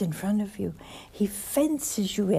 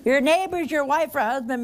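preaching shout; 6 semitones higher, 4 dB louder than usual. Real, not acted. Preacher warning the congregation.

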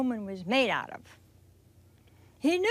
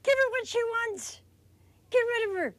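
impatient pleading; 9 semitones higher than usual. Acted. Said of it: "the disciples pleaded with him".

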